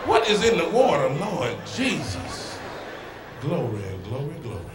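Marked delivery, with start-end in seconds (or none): 1.1-4.7 low, soft churchy glory; mock church praise. Comic admiring a woman, mock praising like in church. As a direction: drawl it like a deacon in awe.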